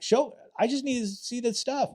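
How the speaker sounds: high, jittery insistent voice; wired pleading. Retold or imitated. Undercover cop pressing the dealer to show drugs, high and wired. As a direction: push to see the drugs, keyed up. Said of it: "I'm like".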